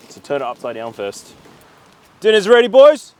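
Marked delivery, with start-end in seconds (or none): 2.2-3.1 calling out loud and high; yelling across the room. Real, not acted. Calling the boys to dinner.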